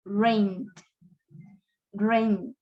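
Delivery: low one-syllable "Rained", N into D; D-only ending. Shown as a demonstration. Teacher models the verb twice, ending straight on D. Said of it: "D, la D, no la".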